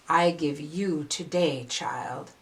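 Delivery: tender birthday wish. Real, not acted. Storyteller gives gift to child.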